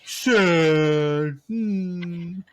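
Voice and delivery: exaggerated mopey "sad"; mock sad whine. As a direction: pout it out like a sulking child. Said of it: "he's like".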